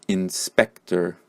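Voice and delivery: "inspector" slowly as in-SPEC-ter; unstressed first syllable. Shown as a demonstration. Teacher revisits word five, slow "inspector" with unstressed first syllable. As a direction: keep the "in" of "inspector" weak, stress "spec". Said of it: "this syllable is also not stressed".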